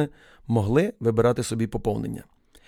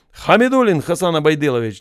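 loud, higher call of the full name; shouting name; 4 semitones higher, 6 dB louder than usual. A narrator's reading. Officer calling a recruit from the crowd, loud shout. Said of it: "закричав він в юрбу".

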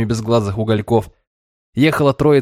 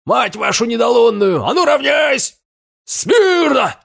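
high barking parade-ground shout; shouting drill sergeant; 13 semitones higher, slower than usual. A narrator's reading. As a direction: yell the drill command at the ranks. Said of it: "раздались крики сержантов".

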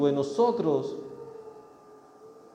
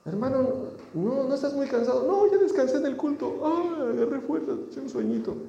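higher put-on voice, smugly rested; sleepy churchgoer; 8 semitones higher than usual. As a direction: tease like someone who napped in church. Brother slept through the service.

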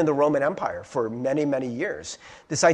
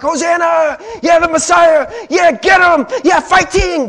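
high, loud hyped-up cheering; cheering crowd; 13 semitones higher, 11 dB louder than usual. Retold or imitated. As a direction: pump up the crowd, yell it. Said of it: "they're like".

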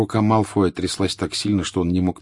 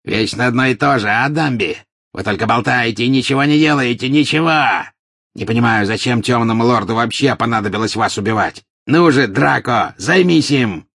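higher, louder, contemptuous; angry taunting; 5 semitones higher, 5 dB louder than usual. A narrator's reading. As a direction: spit the scorn at Dumbledore. Angry Death Eater taunts. (злобно и презрительно, громче)

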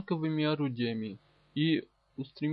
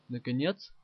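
ordinary delivery

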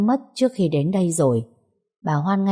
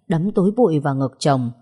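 ordinary delivery, nothing marked

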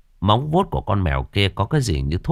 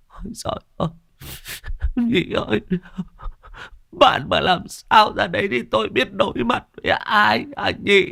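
comic whimpering; 13 semitones higher than usual. Acted. Thọt blubbers at his dying friend's side, high whimpering. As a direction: blubber pleading with your friend not to die. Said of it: "lại mếu máo".

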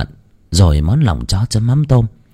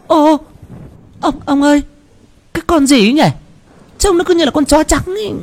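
put-on high old woman alarmed, calling her husband; startled old wife; faster than usual. Bà vợ hoảng thấy bóng trắng. (giọng bà già cao, giật mình gọi chồng)

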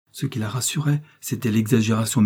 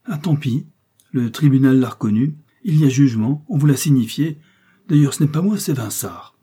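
loud, raised, impatient retort; louder, higher-pitched brush-off; brusque dismissal; 4 semitones higher, 6 dB louder than usual. Acted. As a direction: shrug her off forcefully. Lheureux brusquely dismisses her protest.

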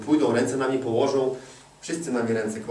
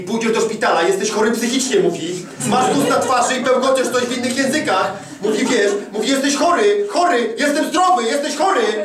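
high, loud, upset wife insisting; husband imitating his wife's panicked pleading; frantic wife; 11 semitones higher, 7 dB louder than usual. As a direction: retell the wife's shaking panic. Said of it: "a ona się trzęsła".

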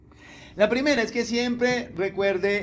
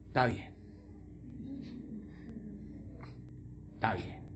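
calmed God; 18 dB quieter than usual. Acted. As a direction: give in quietly like a calmed God. God calmed by Moses' prayer. (Dios calmado, voz baja y resignada)

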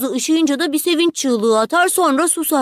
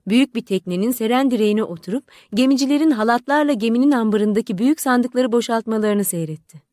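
ordinary delivery

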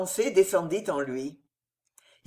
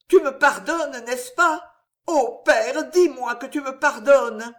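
higher, louder desperate pleading; pleading daughter; 7 semitones higher, 5 dB louder than usual. A narrator's reading. Daughter on her knees begging her father's forgiveness, pleading high and loud. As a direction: beg your father on your knees in tears.

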